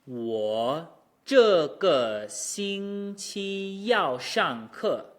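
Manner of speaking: slow, exaggerated tones on "我这个星期要上课"; drawn out with clearly shaped pitches; tone demo. Shown as a demonstration. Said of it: "Difficult entrance, but then an easy exit".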